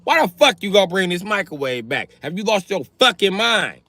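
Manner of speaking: loud, high outraged tirade; mock furious cussing. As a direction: cuss them out. Cussing out the borrower.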